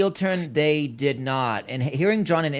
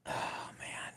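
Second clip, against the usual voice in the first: breathed out almost without voice; whispered hesitation; 20 dB quieter than usual. Real, not acted. Podcaster pondering who wins the match, real whispered reaction. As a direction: whisper it to yourself, weighing the question.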